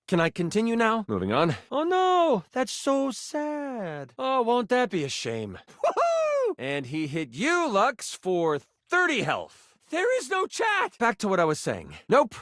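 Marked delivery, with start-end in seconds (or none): 1.7-5.6 high-pitched, drawn-out fake pity; mock sympathy. Game master feigns pity for player. 5.8-6.6 very high celebratory "woo-hoo"; gleeful whoop. Acted. Gleeful whoop during the game. 9.9-11.0 high-pitched, exasperated protest; exasperated outburst. Acted. Exasperated game master corrects player.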